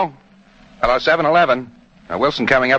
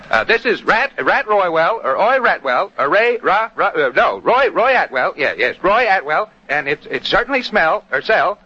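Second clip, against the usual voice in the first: flustered, higher-pitched tongue-tangled announcer; Roy Atwell; 5 semitones higher than usual. Acted. Radio engineer testing for crosstalk, Roy Atwell impression. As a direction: stumble over words and keep correcting yourself. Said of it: "Sounded like Roy Atwell in person".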